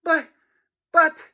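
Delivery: high halting stammer; flustered boy stammering. A narrator's reading. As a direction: trip over the start. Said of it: "Harold stammered".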